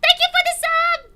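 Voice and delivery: high, loud, laughing thank you; squealing with delighted laughter; excited thanks to chat. Real, not acted. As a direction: gush over the new sub. Thanking a subscriber on stream.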